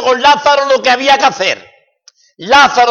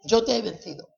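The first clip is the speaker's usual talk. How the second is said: "Yo te he vencido" repeated much softer, an inward echo; hushed, settled repeat of "Yo te he vencido"; 10 dB quieter, faster than usual. Acted. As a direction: let "Yo te he vencido" fall quietly after the shout. Proclaimed then echoed softly.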